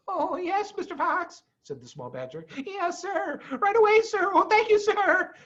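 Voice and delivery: put-on very high, breathless child's voice; eager small badger. A narrator's reading. Small badger answering Mister Fox, eager. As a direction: rush to obey, thrilled.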